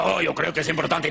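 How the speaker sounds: mock dramatic Spanish voice-over; put-on deep Spanish dub voice; deep dubbing voice. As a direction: do a deep, dramatic dubbed leading man. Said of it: "a guy with a very deep".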